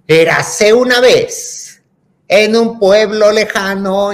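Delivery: loud, high, drawn-out fairy-tale announcing; record storyteller. Retold or imitated. Imitating the record turned up loud, booming storyteller. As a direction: declaim it slowly and grandly. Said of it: "y empieza".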